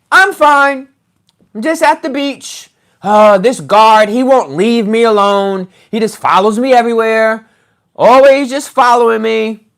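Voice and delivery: high-pitched complaining personality; high voice, put-upon and chatty; Anika. Retold or imitated. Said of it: "another personality came out".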